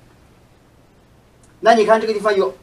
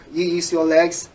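ordinary delivery

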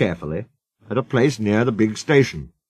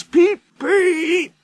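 high imitated engine whistle; squealed train-whistle imitation; hoarse whistle peep. A narrator's reading. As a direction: toot a long, very high peep. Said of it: "They heard a hoarse".

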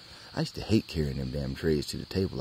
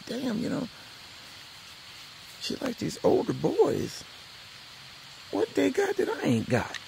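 jealous complaint. Retold or imitated. Jealous boy grumbling about older fellas, high and whiny. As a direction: whine it out with envy.

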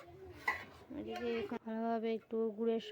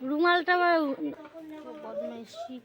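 urgent coaxing; 3 semitones higher, 9 dB louder than usual. Real, not acted. Hurrying toddler, scolding mischief.

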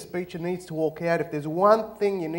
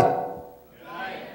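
usual speaking voice

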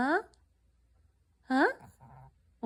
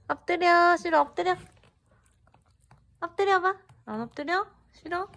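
high, louder command to a pet; talking to a dog; 8 semitones higher, 4 dB louder, faster than usual. Real, not acted. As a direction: urge the dog to lie down. Owner giving the dog commands.

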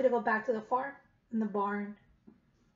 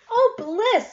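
blissful duck; 8 semitones higher, 8 dB louder than usual. Acted. Duck splashes into tub.

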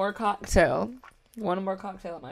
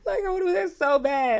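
higher, whiny fake sob; mock crying; 9 semitones higher, 4 dB louder than usual. Fan longing for London, mock crying whine. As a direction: wail it like a joke tantrum.

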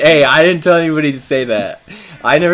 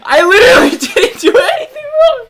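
laughing protest; 4 dB louder than usual. Real, not acted.

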